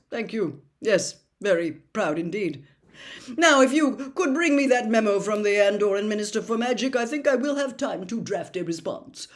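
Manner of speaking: calmly dictating to an imagined clerk; deluded official. A narrator's reading. Crouch chatting to tree.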